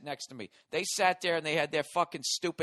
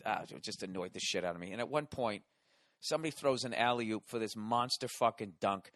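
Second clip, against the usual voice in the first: normal talking voice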